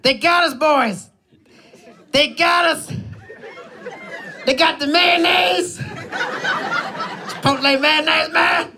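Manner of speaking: high, shouted mock despair, slowed on mayonnaise; hammed-up boss yelling about stolen sauce; mock panic. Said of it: "He goes".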